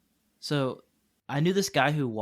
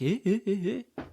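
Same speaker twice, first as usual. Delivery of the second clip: high, puzzled little noises; baffled local; 7 semitones higher than usual. Acted. Imitating a stranger confused by English, baffled high grunts. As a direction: imitate a thrown-off listener, pitched high. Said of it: "they're just like".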